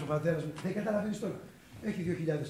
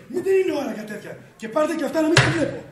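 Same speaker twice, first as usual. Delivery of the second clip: high, loud, hurried dismissal; irritated; 8 semitones higher, 8 dB louder, faster than usual. Acted. Boss ordering Manolis out with his cigarettes, irritated. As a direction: send him off with rising temper. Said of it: "μη με νευριάζεις τώρα".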